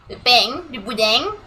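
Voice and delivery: southern French "pain" and "boudin" ending in a ringing ng; singsong southern French accent on "pain". Shown as a demonstration. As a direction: end "pain" like "peng", southern French style. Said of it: "I come from the south of France".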